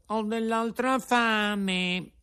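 high, slow whining puppet voice; whimpering. Acted. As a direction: whine it out like a hungry child. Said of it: "disse piagnucolando".

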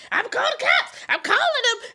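put-on playfully scare the kids like grandma; mock grandma threat. Grandma scaring the little cousin, laughing mock threat. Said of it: "scaring my little cousin".

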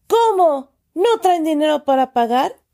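put-on high indignant shout at the kids; loud outraged shout. A narrator's reading. Uncle scolding the kids who cannot pay, shouting. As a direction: shout it so the whole shop hears. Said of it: "gritó tan fuerte que todos".

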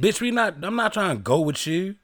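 loud, high, exasperated comeback; indignant retort. Real, not acted. Man defends refusing the girl, loud and exasperated. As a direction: snap back defensively at the teasing.

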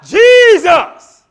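loud high shout of the name; yelled. Retold or imitated. Blind beggar shouting for Jesus over the crowd. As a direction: cry out his name across the crowd. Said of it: "he yelled out".

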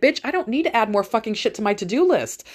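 ordinary talk, nothing marked

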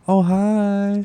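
a high flirty greeting.

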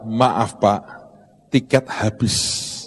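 a lightly voiced clerk, soft and slow; polite ticket clerk. Ticket clerk to angry rich man, soft and slow. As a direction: apologise flatly, slowly, like a weary clerk.

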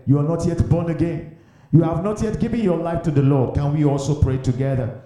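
usual speaking voice

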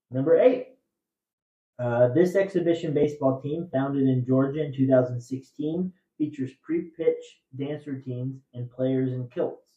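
loud, measured trivia question read; quiz host reading. Acted. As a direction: read the clue out to the room.

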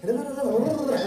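lightly mocking "da, da, da" run; mock rattling list. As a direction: imitate someone reeling off a never-ending list. Mimics spouse rattling off complaints.

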